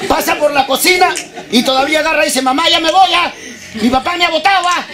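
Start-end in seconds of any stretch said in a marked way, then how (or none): 2.4-4.9 lightly voiced son, higher pitch; a hint of a kid telling on dad; complaining son. Son tells mother that dad kicked him out, complaining.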